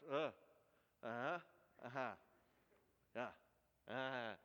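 low grunted uh-huhs, half-hearted; mimicked grunts. Uncle and boy without a shared language, grunting low. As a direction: grunt back and forth like there's no shared language. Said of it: "communicating through grunts".

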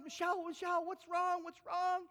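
loud, high, alarmed calling of a name; worried shout. Retold or imitated. Mom runs toward her screaming daughter, alarmed shout. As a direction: shout down the hall in a panic.

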